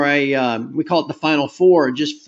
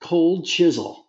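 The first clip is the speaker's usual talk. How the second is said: slow careful repeat; much slower than usual. Real, not acted. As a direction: say the name slowly, as if writing it down. Repeating band name to note it.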